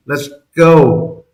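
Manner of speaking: normal talking voice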